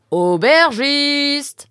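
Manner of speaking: very high, loud, very slow call. A narrator's reading. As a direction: stretch the call out across the inn. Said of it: "cria-t-il à nouveau".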